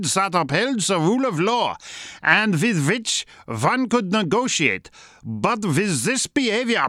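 a mock German accent, hard v for w. Host quoting a German MP in a mock German accent. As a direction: read the German MP's words in a put-on German accent. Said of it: "I'll do it in a German accent".

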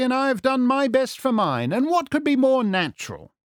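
high-pitched, flowing detective voice; lofty, lilting sleuth; magnanimous Holmes. Acted. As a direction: console a beaten rival with theatrical courtesy. Holmes consoles the captive.

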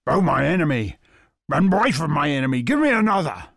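loud, eager begging dog. Acted. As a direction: plead hungrily for another. Dog begs for more.

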